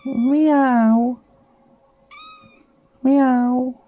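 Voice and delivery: high squeaky put-on meow; cat meow. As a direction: meow like a tiny kitten. Streamer voices cat meowing.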